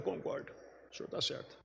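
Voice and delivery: a soft, humble, unvoiced whisper; whispered humble agreement; humble hush. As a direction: concede it quietly and humbly, almost breathless. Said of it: "Eu falei com humildade mesmo".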